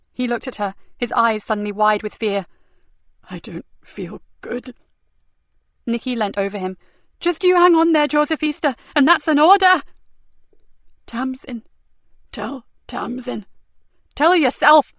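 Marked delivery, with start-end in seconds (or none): 3.3-4.7 weak, fading voice. Acted. Dying Joseph. 7.2-9.8 desperate command. Acted. Nicky ordering him to live. 11.1-13.5 weak, fading voice. Acted. Dying Joseph. 14.1-14.9 desperate command. Acted. Nicky insisting.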